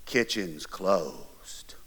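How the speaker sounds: slow, low, breathless whisper from the dark; whispered threat. Retold or imitated. Dad catching a boy raiding the fridge at night, slow low whisper. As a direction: hiss it low like a looming dad.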